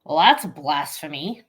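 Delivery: ordinary delivery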